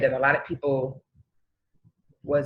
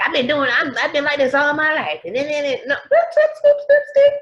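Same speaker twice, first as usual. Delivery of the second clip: high, louder mock whine then squeaky boops; mocking stubborn people; 11 semitones higher, 5 dB louder than usual. Mocking set-in-their-ways people, high squeaky. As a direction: tease them in a silly high voice. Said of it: "those people were like".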